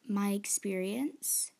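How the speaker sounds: usual speaking voice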